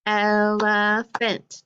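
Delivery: slow, split into syllables; el-e-phant, one clap per part, slow; clapped syllables. Shown as a demonstration. Teacher clapping out syllables for the class, slow and split. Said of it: "this is a long one".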